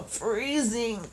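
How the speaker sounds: normal talking voice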